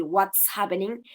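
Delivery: "what's happening" with a voiceless ts closing "what's"; contracted form. Shown as a demonstration. Teacher explaining assimilation of voice, contracted "what's happening". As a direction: say "what's" as one syllable, no z. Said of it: "paso a decir".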